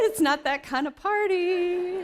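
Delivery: high, loud and slow, hammed-up; mock scolding exclamation. Real, not acted. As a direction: play-act shocked reprimand, drawn out. Joking about nearly swearing.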